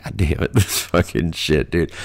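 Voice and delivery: low, hushed, half-laughed cursing; retold stunned reaction. Reacting to a horse in the bar at night's end, stunned. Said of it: "you're just like".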